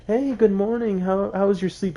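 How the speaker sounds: high, bright mock-cheerful greeting; chirpy relative greeting. Relative greeting her downstairs, high and chirpy. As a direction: imitate a sunny family member saying good morning. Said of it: "they're like".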